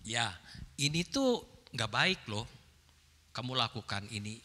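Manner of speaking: low, soft, measured correction; properly delivered advice. Shown as a demonstration. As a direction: tell them gently in a low tone. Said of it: "Nyampaikannya benar". (teguran lembut bernada rendah)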